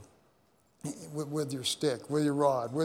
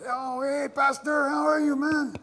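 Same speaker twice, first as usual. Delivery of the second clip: high, louder cheerful hey Pastor; barbers' loud greeting; 11 semitones higher, 4 dB louder than usual. Retold or imitated. Barbers hailing the pastor as he walks in, high loud greeting. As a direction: call out a bright friendly welcome.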